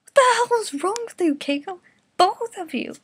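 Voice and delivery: high, loud horrified yell; screaming. Acted. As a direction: scream it at them in disbelief. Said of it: "Yusuke screamed".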